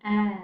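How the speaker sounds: short e sound Eh said alone; isolated vowel. Shown as a demonstration. Teacher isolating the short e for pupils. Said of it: "let's take a look at that vowel".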